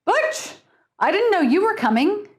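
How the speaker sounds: high, fast irritated host voice; displeased man. Acted.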